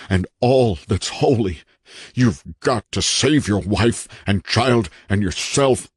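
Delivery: strained warning building to urgency; desperate haunted man, pleading. A narrator's reading. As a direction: plead with the visitor to believe.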